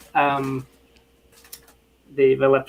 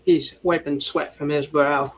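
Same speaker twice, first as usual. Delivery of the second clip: ordinary delivery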